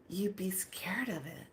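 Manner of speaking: soft, near-whispered mock warning; hushed warning. Door lady warning about thing's real form, hushed. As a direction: drop to a whisper as if it's frightening. Attendant hinting at scary true form.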